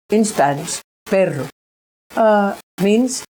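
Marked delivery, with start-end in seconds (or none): none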